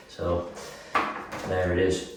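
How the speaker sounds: ordinary delivery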